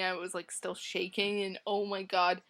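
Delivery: ordinary talk, nothing marked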